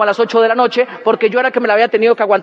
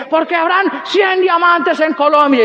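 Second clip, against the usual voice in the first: slow, higher mock rally cry; rallying speaker; 4 semitones higher, slower than usual. Someone onstage rousing the crowd, mock rallying cry. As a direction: stretch it like a hype man. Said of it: "sale alguien y dice".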